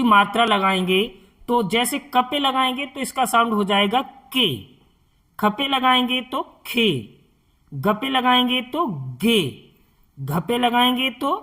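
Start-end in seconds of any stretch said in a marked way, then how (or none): 4.3-4.6 के said as a short 'ki'; short i sound. Shown as a demonstration. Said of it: "इस तरह से छोटी ई का साउंड". 6.7-6.9 खे said as a short 'khi'; short i sound. Shown as a demonstration. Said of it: "इस तरह से छोटी ई का sound". 9.2-9.4 गे said as a short 'gi'; short i sound. Shown as a demonstration. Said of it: "इस तरह से छोटी ई का साउंड".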